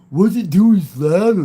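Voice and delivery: high, loud imitated chatter; playful high-pitched mimicry; mock overheard voice. Mimicking people overheard nearby.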